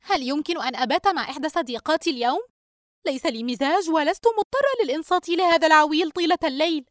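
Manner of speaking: mildly grumbling daughter. Acted. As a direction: complain with a touch of annoyance. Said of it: "رجاء بتذمر". (بتذمر خفيف)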